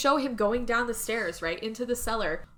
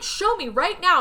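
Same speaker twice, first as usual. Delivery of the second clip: louder, higher demand; forceful demand; 8 semitones higher, 6 dB louder than usual. Retold or imitated. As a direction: bark the order. Husband demanding to see body.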